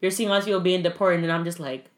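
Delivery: normal talking voice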